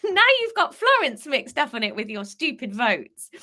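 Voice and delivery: normal talking voice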